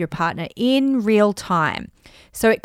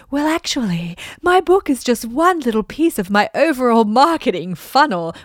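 over-explaining; 4 semitones higher, 4 dB louder than usual. Retold or imitated. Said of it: "this is what I used to say".

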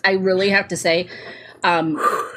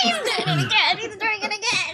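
high squeal through laughter; laughing; 13 semitones higher, 4 dB quieter than usual. Real, not acted. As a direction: giggle the words out. Laughing at co-host.

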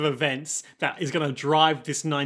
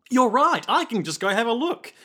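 acted burst of eagerness, higher and louder; sudden bright turnaround; 7 semitones higher, 4 dB louder than usual. Character told he could go kill people, suddenly eager. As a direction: play a mood flipping in an instant. Acting out a sudden mood swing.

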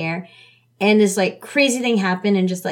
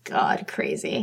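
breathy unvoiced lower murmur; whispered disbelief; 5 dB quieter, slower than usual. Real, not acted. Reacting to the drama's cruel plot, whispered.

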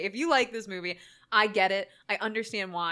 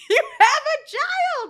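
high, loud, drawn-out protest; exasperated mock yell; 8 dB louder, slower than usual. Viewer to the forgetful dad, exasperated high yell. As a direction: yell it slowly in comic disbelief.

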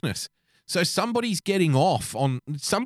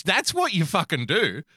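ordinary talk

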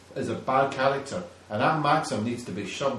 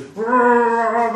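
loud growl with a high pitch; mock monster roar; 8 semitones higher, 9 dB louder than usual. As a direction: growl like a charging beast. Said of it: "He goes".

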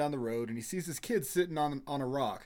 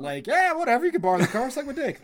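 laughing mock dad; 6 semitones higher, 8 dB louder than usual. Imitating the forgetful dad.

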